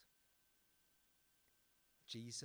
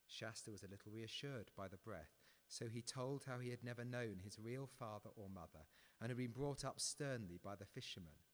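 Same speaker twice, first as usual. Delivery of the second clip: ordinary talk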